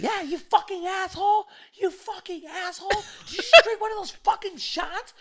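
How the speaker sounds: squealing falsetto tirade; mock a furious girl in a very high voice; imitated angry girl. Girl cursing the man who took her shot, hammed-up falsetto rage. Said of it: "He's like".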